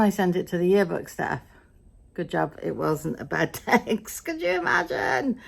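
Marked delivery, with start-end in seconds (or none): 4.3-5.4 high, disbelieving aside; mock horror. Real, not acted. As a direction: gasp at the thought of it. Aside after an embarrassing mistake.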